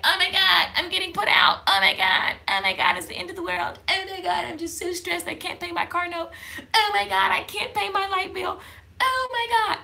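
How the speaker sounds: high, frantic mock worry, rising as it goes; imitating panic. Vlogger acting out eviction panic for viewers. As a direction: play the broke, stressed-out self spiralling. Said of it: "you was stressed out all night".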